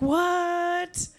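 put-on a small girl who is baffled; innocent child. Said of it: "I'm like".

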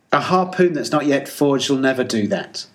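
usual speaking voice

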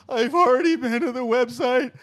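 high-pitched imitation of upset caller; crying caller. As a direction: act out a distraught caller pleading. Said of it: "so she's crying".

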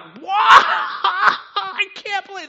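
high, shouted laugh of disbelief; hysterical disbelief. Acted. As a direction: burst out laughing like an incredulous crowd. Listeners crack up at the excuse.

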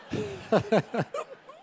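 a high, delighted burst of laughter. Real, not acted. Pastor laughing at his own turkey joke.

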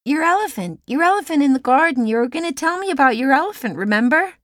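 put-on loud, high, fast eager boy; excited child. A narrator's reading. Breathless Carl eagerly reminds Lizzie of the elephant, loud and high. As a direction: blurt it out, bursting with eagerness.

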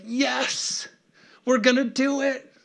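high, giddy mock cheer; excited congregation. Preacher imitating believers cheering God's plan, excited. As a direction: squeal with eager confidence. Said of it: "just like we're so excited".

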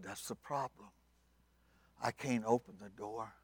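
put-on hushed, resigned old man's reply; grave admission. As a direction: admit it quietly and solemnly. Noah answers quietly.

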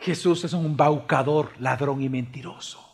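very low, soft sermon voice with long pauses; hushed, grave preaching. Real, not acted. Preacher voices the unthinkable to the church, grave hush. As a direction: let each bleak statement sink in quietly.

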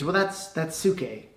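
usual speaking voice

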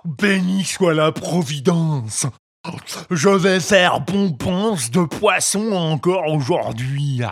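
loud, slow ogre-like fisherman, half sung; a gleeful brute crowing over his catch; green fisherman voice. A narrator's reading. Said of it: "s'exclama tout content". (voix d'ogre joyeux, lente et chantée)

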